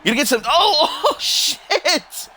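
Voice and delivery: high laughing cry of surprise; laughing shout. Real, not acted. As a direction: yelp and laugh at the big move. Surprised big move in match.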